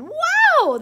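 high, loud, drawn wow; amazed wow. A narrator's reading. Bandmates hear the fly's new sound, loud amazed cry. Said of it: "Willie and Nancy and Sammy said".

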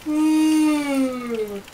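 big yawn. Real, not acted. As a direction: yawn through it. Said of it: "Stop yawning!".

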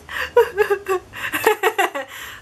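real giddy laugh trailing off; happy laugh. Real, not acted. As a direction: laugh with delight at the memory. Laughs after recalling the yes.

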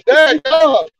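loud, high, amazed reaction; amazed exclamation. Real, not acted. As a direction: react big to the frozen face. Reacting to frozen friend.

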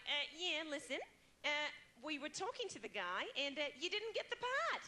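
Australian manager lilting up at every phrase end; Australian uptalk. Acted. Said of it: "I have that kind of up talk".

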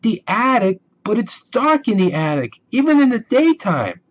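high, rising alarm at the attic; scared protest. Acted. Sister afraid of the dark attic, high alarmed protest. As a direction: balk in fear.

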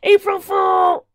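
long, high, very loud shout. Acted. Pete shouting his prank at everyone, triumphant. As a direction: stretch it out and yell it. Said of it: "shouted Pete".

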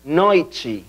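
NOITE as "NOY-chee"; Portuguese pronunciation. Shown as a demonstration. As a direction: glide oi, then "chee" to end NOITE. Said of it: "Atención a la pronunciación de la palabra noche".

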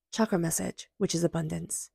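ordinary talk

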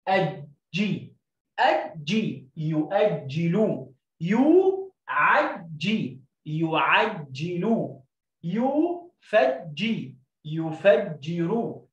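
each word drawn out very slow; teacher stretching يفجر; slow blending. Shown as a demonstration. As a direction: blend syllables slowly for the class. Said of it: "زي كلمة يو".

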